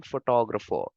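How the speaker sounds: photographer with an English stressed t; English word. Shown as a demonstration. Teacher gives the English word for contrast. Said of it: "Photographer in English".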